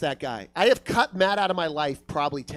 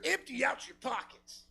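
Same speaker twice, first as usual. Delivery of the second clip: soft, higher, distant demand; a disembodied robber's voice, barely there; faint mysterious voice; 5 semitones higher, 11 dB quieter than usual. Retold or imitated. Unseen robber calling to a passer-by, faint and eerie.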